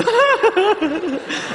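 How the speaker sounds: high fake laugh; put-on laugh of someone lulled into security; mock carefree laugh. Comedian playing a laugh snorter who forgot, laughing freely. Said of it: "lulled into a false sense".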